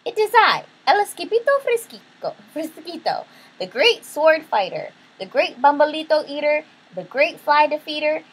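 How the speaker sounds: high, loud, boastful little hero; Skipito. A narrator's reading. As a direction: proclaim grand titles in a high voice, Spanish flourishes. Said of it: "said Skipito".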